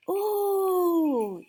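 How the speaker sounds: high, loud gasping ooh; high astonished ooh. Acted. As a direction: gasp in wonder. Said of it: "the crowd gasped".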